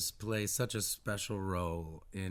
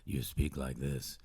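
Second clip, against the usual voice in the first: slow, much lower mock French-speaking voice; dropped voice; 7 semitones lower, slower than usual. Shown as a demonstration. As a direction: drop deep, imitating your own French persona. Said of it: "my voice drops".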